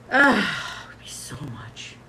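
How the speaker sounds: breathy whisper, slow, low; whispered groan. Real, not acted. Host whispering to viewers about gas costs, weary. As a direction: sigh it out under your breath.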